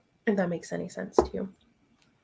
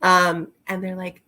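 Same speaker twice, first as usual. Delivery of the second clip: normal talking voice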